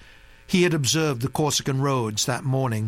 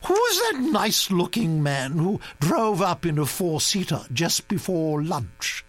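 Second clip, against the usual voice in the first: high, commanding grand-lady voice; a bossy old aristocrat laying down plans; imperious old Duchess; 8 semitones higher than usual. A narrator's reading.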